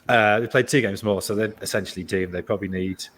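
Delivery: ordinary delivery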